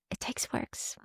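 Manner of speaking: fading into a whisper; soft whispered afterthought. Real, not acted. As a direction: whisper it as a private aside. Closing aside on effort.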